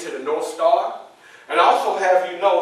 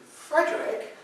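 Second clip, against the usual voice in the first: lady's call; 10 semitones higher, 5 dB quieter, slower than usual. Retold or imitated. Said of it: "that's how they were speaking".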